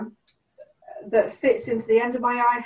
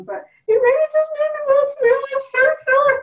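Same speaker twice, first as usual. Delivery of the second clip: tearful; 7 dB louder than usual. Retold or imitated. Telling the fellowship panel it means the world, voice breaking. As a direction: voice cracking high and loud with emotion.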